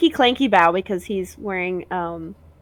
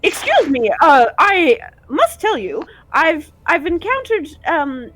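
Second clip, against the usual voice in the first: formal, raised knight voice; 5 semitones higher, 3 dB louder than usual. Acted. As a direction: speak as a dutiful knight. GM voices knight NPC speaking.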